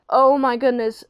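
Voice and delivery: mock-horrified exclamation; freaking out. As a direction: act out a mother's alarm. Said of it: "starts freaking out".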